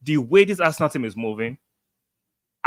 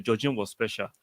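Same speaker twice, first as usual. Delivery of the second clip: normal talking voice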